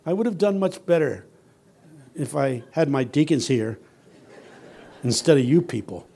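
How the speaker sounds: ordinary delivery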